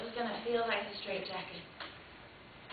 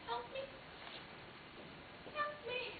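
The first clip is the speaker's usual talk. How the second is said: high, softer, tearful plea; sobbing plea; 13 semitones higher, 14 dB quieter than usual. Retold or imitated. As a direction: beg for help through sobs. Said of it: "I just started sobbing".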